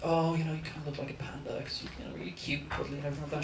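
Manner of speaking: very quiet, hurried at the end; soft fond musing. Retold or imitated. Man looking at his fiancée and thinking, soft fond musing. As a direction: think it aloud softly, almost under your breath.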